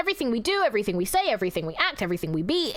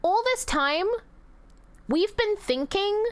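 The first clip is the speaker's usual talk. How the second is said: higher, slow, theatrical reveal; mock dramatic revelation; 9 semitones higher than usual. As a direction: announce it like a grand discovery. Dramatic revelation about wishcraft.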